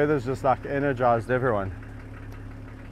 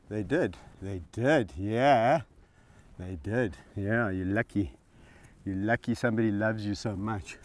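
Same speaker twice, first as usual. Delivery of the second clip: soothing; 4 semitones lower, 6 dB quieter than usual. Real, not acted. Soothing the dog while lying down.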